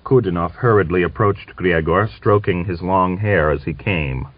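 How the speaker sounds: usual speaking voice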